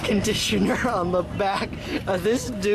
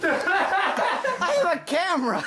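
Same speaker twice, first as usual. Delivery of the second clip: laughing exclaim; 9 semitones higher, slower than usual. Real, not acted. Laughing mock alarm in room.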